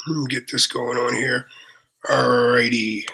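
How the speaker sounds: normal talking voice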